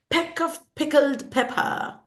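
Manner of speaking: high-pitched "Peck of pickled pepper", every p popped; repeated p sound. Shown as a demonstration. Teacher demonstrating alliteration, popped p's. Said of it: "pa sound is repeated".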